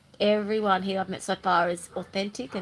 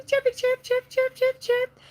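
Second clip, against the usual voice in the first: high, louder chirping; chirpy bird sounds; 3 dB louder than usual. Shown as a demonstration. Vlogger demonstrating her chirpy energy, high bird chirps. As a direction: chirp it up like a sparrow. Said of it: "like a little chirpy".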